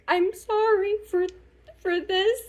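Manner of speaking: high, mock-tearful apology; imitating a crying, halting thank-you; retold tearful apology. Apologizing to the manager while quitting, mock tearful. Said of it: "I was crying while talking to my manager".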